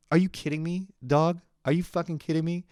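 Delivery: high-pitched disbelief; incredulous. Real, not acted. Son reacting to mom's loving greeting, incredulous. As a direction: ask it high, in amazed disbelief.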